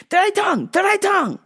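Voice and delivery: earnest lady with a strange request; lightly accented old lady; foreign lady voice. Retold or imitated. Lady pleading, pointing at mouth.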